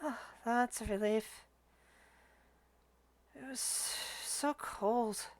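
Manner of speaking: very soft, hesitant ghost soldier; whispering ghost. Acted. Ghost soldier answers the adventurer with relief, hushed. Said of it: "a voice whisper".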